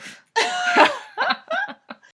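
high, loud laughing shriek; burst of laughter. Real, not acted. Laughing at her own daddy issues remark, high burst of laughter.

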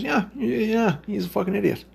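high-pitched imitation of a kid; kid's response. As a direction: mimic a young kid, pitched well up. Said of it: "my kid's response was".

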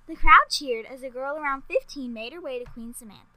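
ordinary delivery, nothing marked

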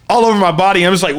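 ordinary talk, nothing marked

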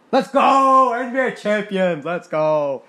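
loud, high triumphant yell; victory shout. Real, not acted. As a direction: celebrate the title at the top of your lungs. Winning the NBA championship.

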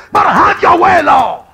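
high loud plea; preaching shout. Acted. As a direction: cry it out to the Lord. Preacher crying out.

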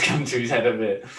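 ordinary delivery

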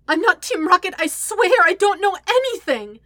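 high, loud, panicked protest; blubbering. A narrator's reading. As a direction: plead frantically, on the verge of tears. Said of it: "the pinned man blubbers".

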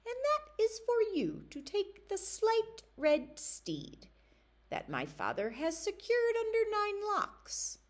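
high, eerie enchanter's daughter; sly high voice of a young witch. Acted. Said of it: "she said".

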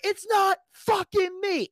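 very high, drawn-out angry shout; retold indignant yell; mad protest. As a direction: yell your innocence in exasperation. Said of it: "I was so mad".

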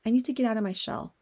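normal talking voice

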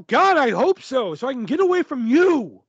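hammed-up fed-up shout; exasperated retort. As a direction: draw out the comeback in exaggerated exasperation. Acted retort in retold quarrel.

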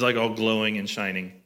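ordinary talk, nothing marked

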